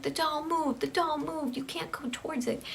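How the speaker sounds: high-pitched, lightly acted panic; scared, tearful child. Tammy warning Emily off the moved doll, mock tearful fright. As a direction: imitate a frightened girl's plea, without real sobs. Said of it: "Tammy is just crying. She's sobbing. She's so scared".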